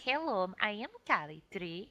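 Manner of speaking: Calatrix. Acted. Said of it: "I'd have to do the accent".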